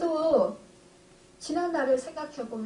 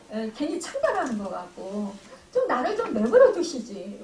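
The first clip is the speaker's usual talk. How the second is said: ordinary talk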